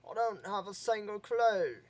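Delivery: mock British accent, stressing "clue". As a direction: play a Brit who is cluelessly cheerful. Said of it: "I just like doing British accents".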